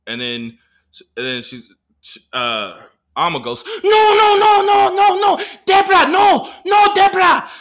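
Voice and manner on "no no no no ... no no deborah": high, loud frantic cries; hammed-up high-pitched panic; panicked protest. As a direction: shriek it like an alarmed friend intervening. Acting Alma frantically stopping mom.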